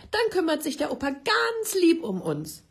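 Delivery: put-on high, loud, cheerful little boy's voice; cheerful child. Mother retells son leaping off the sofa, high cheerful. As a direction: bounce it out like a relieved child jumping up. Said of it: "plötzlich er ganz fröhlich vom Sofa aufsprang".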